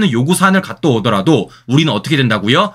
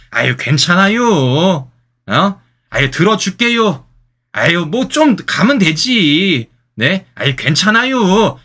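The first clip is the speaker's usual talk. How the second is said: slow, easygoing Chungcheong drawl; laid-back Chungcheong folk speech, stretched out slowly; Chungcheong dialect; 3 semitones higher, slower than usual. Retold or imitated. Commentator imitating relaxed Chungcheong locals, slow Chungcheong drawl. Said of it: "충청도 분들의 그런 말씀을 좀 따라야".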